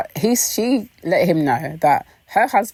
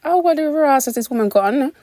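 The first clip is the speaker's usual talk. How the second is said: shocked gasp; 11 semitones higher than usual. Retold or imitated. Vlogger reacting to Phaedra's outfit, high and shocked. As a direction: react as if seeing her half naked.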